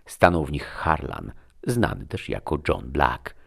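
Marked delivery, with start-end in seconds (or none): none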